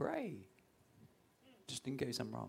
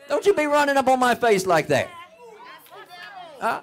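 full-force preaching; 16 dB louder than usual. Acted.

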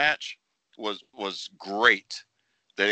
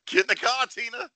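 laughing movie quote; 13 semitones higher, faster than usual. Retold or imitated.

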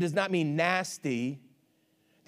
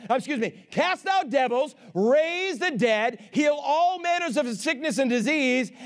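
emphatic preacher voice, higher than normal talk; full-force preaching; 6 semitones higher than usual. Real, not acted. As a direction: punch each phrase out to the congregation.

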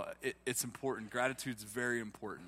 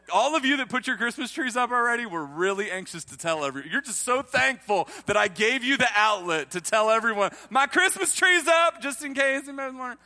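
much higher, louder teasing; comic teasing; 9 semitones higher, 10 dB louder than usual. Real, not acted. Pastor teasing tree-decorators, high and loud. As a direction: poke fun, grinning.

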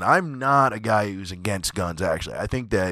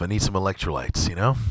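ordinary talk

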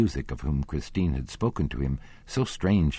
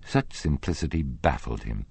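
usual speaking voice

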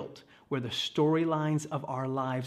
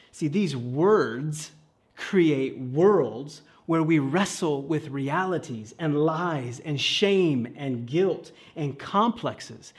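slow, louder preacher emphasis; slow preaching; 5 dB louder, much slower than usual. Real, not acted. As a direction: lean hard on each word, slowly. Preaching at slow full force.

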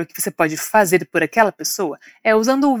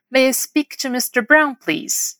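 whole model phrase; 3 semitones higher, slower than usual. Shown as a demonstration. Teacher modelling a phone request, slow and connected. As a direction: run "May I speak to mister Brown, please" together, unhurried. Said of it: "Repete depois de mim".

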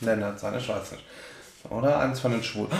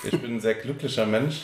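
ordinary talk

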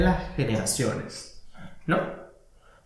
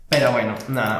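usual speaking voice

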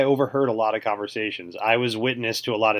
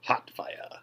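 whisper; 9 dB quieter than usual. Acted. Whispered echo.

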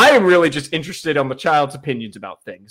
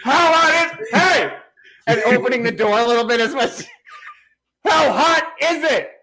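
much higher, laughing mock call; mock yell from afar; 6 semitones higher than usual. Imagined parent calling back to the cursing child, mock yell. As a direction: shout it through a half-open door. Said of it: "going like".

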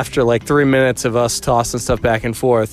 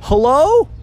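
high, loud call into a cave; boy calling out; 5 dB louder than usual. Retold or imitated. Boy yells into the cave, high loud call. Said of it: "And the boy started yelling".

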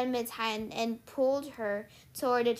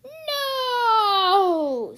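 high, long wailing no; loud moan; 10 semitones higher, 10 dB louder than usual. A narrator's reading. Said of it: "Sears moaned".

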